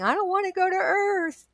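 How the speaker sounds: high, pleading mock wail; crying. Retelling her protest to the therapist, crying. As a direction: imitate a tearful, reluctant plea. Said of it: "I was crying, and I was saying".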